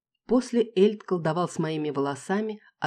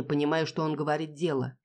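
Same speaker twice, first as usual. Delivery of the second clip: usual speaking voice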